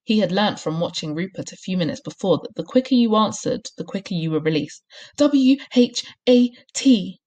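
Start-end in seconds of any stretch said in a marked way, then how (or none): 5.2-7.2 high, loud letters, spaced out; squealed. A narrator's reading. Boy held by his ears spelling for release, squealing. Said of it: "he squealed".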